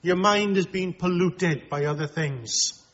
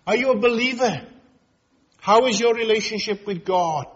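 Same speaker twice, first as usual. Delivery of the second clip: raised, urgent preacher's voice, questions fired fast; full-force preaching; 4 semitones higher than usual. Acted. Preacher warning his congregation, urgent and raised. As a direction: press the congregation hard about their relationship with God.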